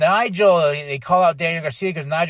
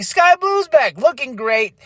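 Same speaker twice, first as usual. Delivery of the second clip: excited cheer; 8 semitones higher, slower than usual. Real, not acted. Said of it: "I'm like".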